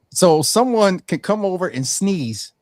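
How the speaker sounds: ordinary delivery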